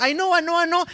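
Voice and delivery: put-on high-pitched teenage "I know"; teen brushing off. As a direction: toss it off like a bored teen. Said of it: "llegamos a la adolescencia".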